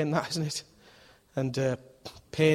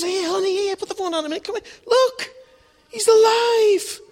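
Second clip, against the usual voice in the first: high, loud, excited announcement; excited dad; 7 dB louder than usual. Acted. Dad calling daughter to see the goldfish alive, high and excited. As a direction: call her over, thrilled and high. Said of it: "He says".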